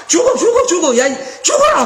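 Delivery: hammed-up cursing wife; mock cursing. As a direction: spit out the curses like Job's wife. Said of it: "막 이런, 이런 독설을 퍼붓고".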